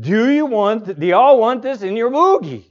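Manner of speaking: high, loud imitated Southern drawl; thick Southern drawl. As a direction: mimic an insistent Southern cashier. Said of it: "thick Southern drawl".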